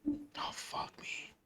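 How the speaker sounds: breathy whispered curse. Real, not acted. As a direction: curse under your breath. Player reacts under breath.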